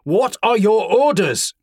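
loud, slow call for orders; shouting. A narrator's reading. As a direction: shout it across the hall. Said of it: "shouted Pavel".

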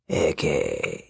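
deep, slow, growled villain voice; low snarling wolfman. Acted. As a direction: growl it low like a wolf king. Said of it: "The Wolfman snarled".

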